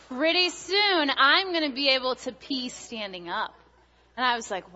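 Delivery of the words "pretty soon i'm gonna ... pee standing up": put-on excited little boy. Toddler telling Mommy his grown-up plans, eager child voice. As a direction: savour the build-up like a kid with a secret.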